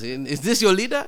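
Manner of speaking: a clumsy fake Wakandan accent, said deliberately; mock Wakandan accent. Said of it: "that's a fucked up accent".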